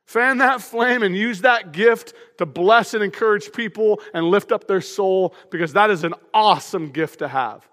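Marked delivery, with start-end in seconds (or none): none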